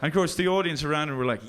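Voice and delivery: ordinary talk, nothing marked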